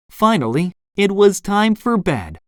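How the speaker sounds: ordinary delivery, nothing marked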